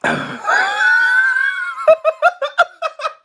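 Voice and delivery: very high shrieking laugh; long squealed cackle; real laughing fit. Real, not acted. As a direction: explode into helpless laughter. Laughing fit starts up again.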